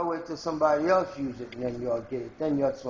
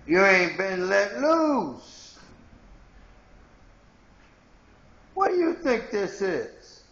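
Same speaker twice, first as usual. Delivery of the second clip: heated rant; 6 semitones higher, 5 dB louder, slower than usual. Real, not acted.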